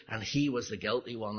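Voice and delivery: ordinary talk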